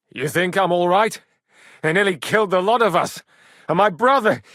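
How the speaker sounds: loud, high and fast; rising indignant rant; agitated outburst. Acted. Upset after battle.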